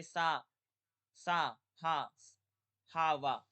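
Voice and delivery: सा, हा, वा slow and loud; drawn out syllables for the class; fricative demo. Shown as a demonstration. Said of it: "कौन कौन से माने जाते हैं".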